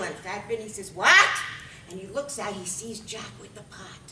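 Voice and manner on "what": loud, rising yelp of disbelief; baffled shout; startled question. Acted. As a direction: shoot it up at the end, loud. Fat Vinny reacts to shouted thanks.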